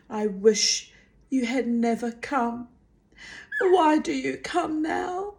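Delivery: high, tearful breaking voice; crying. A narrator's reading. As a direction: let the voice crack into tears. Molly begins crying.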